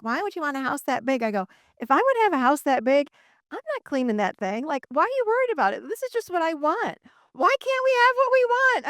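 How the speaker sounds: indignant pleading rising to a high peak; exasperated retort. Retold or imitated. Retelling the family argument about a big house, exasperated.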